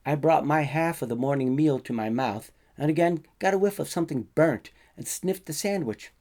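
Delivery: ordinary delivery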